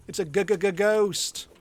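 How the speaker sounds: slow, stuttering cartoon scaredy-cat; hammed-up scared stammer like a cartoon; mock stammering fright. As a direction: ham up a shaking stutter on ghost.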